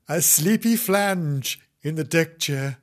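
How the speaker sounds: ordinary delivery, nothing marked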